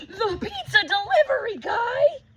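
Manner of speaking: high, loud, laughing surprise; delighted surprise. Acted. Child spotting the pizza guy at the window, delighted. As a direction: squeal it with glee.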